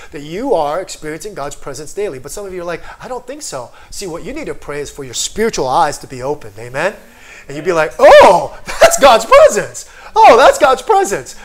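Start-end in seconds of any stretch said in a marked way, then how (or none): 3.0-3.7 soft, higher-pitched doubter; doubtful reply. Retold or imitated. Voices a doubting listener. 8.0-11.4 loud, high amazed outburst; sudden realization. Acted.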